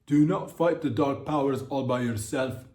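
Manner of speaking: a put-on Nigerian accent, mock ominous. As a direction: ham up a Nigerian Snape warning against dark powers. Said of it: "my Nigerian accent".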